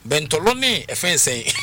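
ordinary talk, nothing marked

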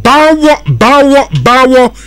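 high, loud sign-off cry; excited call-out. Acted. Closing call-out of the ad.

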